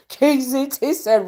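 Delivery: usual speaking voice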